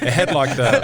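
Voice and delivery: breaking into laughter mid-sentence; real laugh bubbling through the words; laughing while telling it. Real, not acted. Cracking up mid-story.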